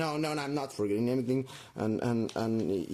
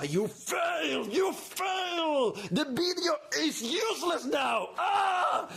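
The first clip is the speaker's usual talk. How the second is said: much higher, slow accusing shout; inner critic screaming; 13 semitones higher, slower than usual. Acted. Her inner voice scolds her for a spoiled one-take video, much higher accusing shout. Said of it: "the voice in your head will start screaming at you".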